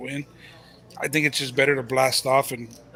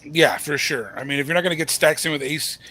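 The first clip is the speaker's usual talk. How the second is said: ordinary talk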